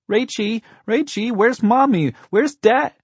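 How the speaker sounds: put-on very high, loud child voice; piercing small-boy plea; frantic little boy. A narrator's reading. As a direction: beg big sister to find parents. Little brother frantic for parents.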